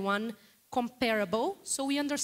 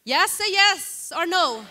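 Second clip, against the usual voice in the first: calling out; 5 semitones higher, 8 dB louder, slower than usual. Real, not acted. Calling out to the hall.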